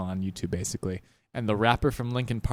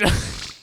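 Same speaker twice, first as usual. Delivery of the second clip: monster grunt laugh. Pretend. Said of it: "What the fuck was that?".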